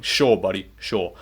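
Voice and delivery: low, quiet, dry sarcasm; sarcastic dismissal. Real, not acted. Vlogger sarcastically brushes off a rival's excuse, low and quiet.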